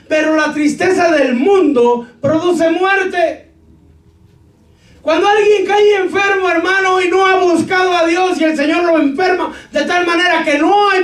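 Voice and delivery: high, loud, slow preaching; preaching at full force. Acted. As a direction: thunder each phrase slowly at the church. (predicador a todo pulmón, lento y fuerte)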